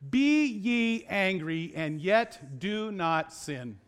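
slow, weighty scripture quote; King James quotation. Retold or imitated. Preacher quoting the old King James, slow deliberate recital. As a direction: recite it slowly, stretching each word. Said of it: "The old King James says".

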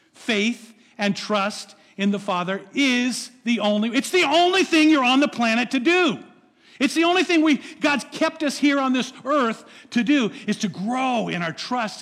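loud, high preacher's push; fervent preaching. Acted. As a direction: preach it with full fire. Preaching at full force.

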